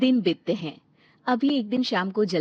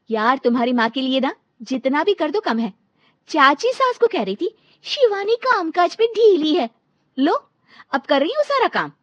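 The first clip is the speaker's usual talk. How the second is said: louder, higher, fuming rant; angry venting; 5 semitones higher, 7 dB louder than usual. Acted. Wife venting to her husband about his mother, fuming. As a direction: blow off steam at the husband.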